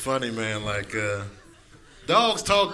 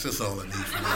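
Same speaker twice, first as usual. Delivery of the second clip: usual speaking voice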